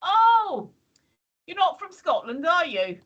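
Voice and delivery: retelling a shop assistant in a mock Scottish accent. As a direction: imitate a Scottish shop lad, lightly. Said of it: "In, like, in a Scottish accent".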